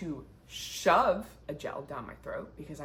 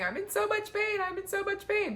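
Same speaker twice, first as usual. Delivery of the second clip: hammed-up high wail of pain, much higher pitch; dramatic complaining; 4 dB louder than usual. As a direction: overplay the agony like a drama queen. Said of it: "I was so dramatic".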